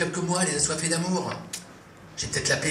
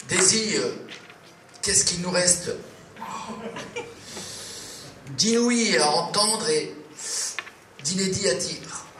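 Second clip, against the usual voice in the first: higher, slow and theatrical; grandiose poetic question; 3 semitones higher, slower than usual. Acted. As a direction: declaim it like a bad poet, slowly. Pompous poetic question to Daisy.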